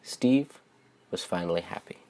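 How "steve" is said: ordinary delivery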